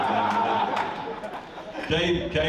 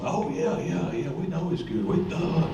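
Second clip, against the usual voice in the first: higher-voiced imitation of an offhand "of course" reply; mock dismissive agreement; 7 semitones higher than usual. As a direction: brush it off as if obvious, pitched up. Acting out other coach's breezy reply.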